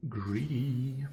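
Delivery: breathy muttered jab under the breath; whispered jab. Real, not acted. Streamer mutters about opponent's greedy play, in a whisper. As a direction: whisper it like a private dig at the opponent.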